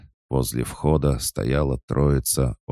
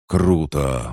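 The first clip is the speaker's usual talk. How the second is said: excited louder exclamation; exclaiming; 4 semitones higher, 5 dB louder than usual. A narrator's reading. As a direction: burst out with delight. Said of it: "воскликнули пацаны".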